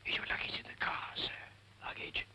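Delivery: soft whisper. Acted. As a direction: whisper it politely. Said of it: "What are you whispering about?".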